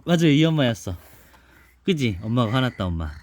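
plain reading voice

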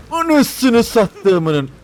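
loud, slow, high exasperated curse; long loud frustrated swear; drawn-out curse. Real, not acted.

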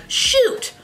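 high, prissy fake-polite curses; prim censored swearing. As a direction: act like a goody-goody who won't swear. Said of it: "or say things like".

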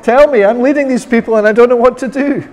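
high, fast, frantic pleading; panicked cry for help. Retold or imitated. Moses crying out to the Lord in panic, high and frantic. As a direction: voice Moses desperate and lost. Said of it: "Moses is crying out to the Lord, saying".

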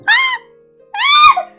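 high, loud panicked cries; startled shriek. Real, not acted. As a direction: shriek in sudden alarm. Kitchen mishap.

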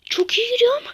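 loud, high burst of glee; gleeful gamer shout; excited cheer. Real, not acted. Gamer cheering own progress.

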